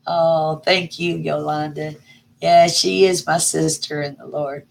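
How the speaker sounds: ordinary talk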